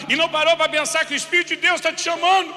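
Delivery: scolding rebuke. Retold or imitated. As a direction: drive the point home, exasperated. Pastor scolding the man.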